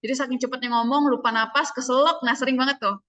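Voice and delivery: ordinary talk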